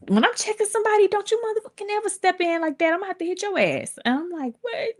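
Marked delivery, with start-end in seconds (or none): 0.1-4.0 high, fast mock threat; retold scolding. Girlfriend warns teller not to step in, high scolding. 4.6-4.9 small, high, softer squeak; mock-tearful little question; retold tearful shock. Teller answers girlfriend while crying, high and small. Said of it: "I'm crying".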